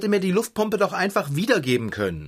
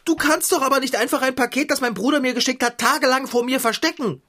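fast, higher scolding; quick upset protest; outraged reproach; 7 semitones higher, faster than usual. Acted. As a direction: tell him off in a rush.

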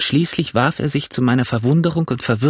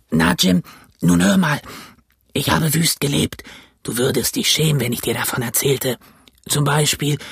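put-on old castaway; 4 semitones higher than usual. A narrator's reading. Castaway telling Jim he was marooned, rough voice.

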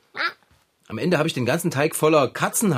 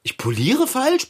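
slow, much higher offended question; indignant disbelief; 9 semitones higher, slower than usual. Acted. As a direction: repeat it back, outraged. Indignant at criticism.

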